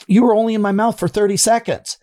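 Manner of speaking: high, pressed retold complaint; indignant protest. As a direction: protest the price to the dentist. Patient protesting to dentist.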